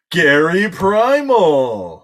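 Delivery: slow, loud drawn-out announcing; announcer summon. Acted. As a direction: call the name out like a ring announcer. Announcing big card summon.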